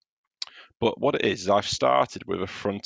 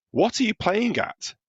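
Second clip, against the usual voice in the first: much higher, slow bossy voice; bossy yell; 14 semitones higher than usual. Acted. Teacher voicing Little Miss Bossy yelling across the road, high and bossy.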